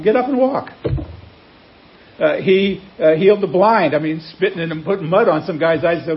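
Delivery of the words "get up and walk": high ringing command; commanding Jesus. Acted. Jesus orders the lame man to rise, ringing high command. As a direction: call it out like a healer's order.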